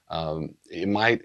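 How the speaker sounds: ordinary delivery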